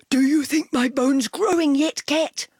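loud eager dog bark. A narrator's reading. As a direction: blurt it out like an excited dog.

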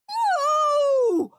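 high, loud howl; child's howling no. Acted. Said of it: "howled horrid Henry".

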